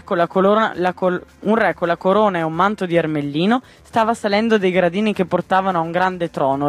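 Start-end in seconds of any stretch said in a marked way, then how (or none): none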